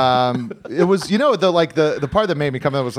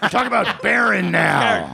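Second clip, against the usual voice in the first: mock heckler shout; 6 semitones higher than usual.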